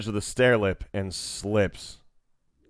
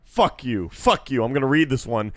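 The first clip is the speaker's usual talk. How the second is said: ordinary talk, nothing marked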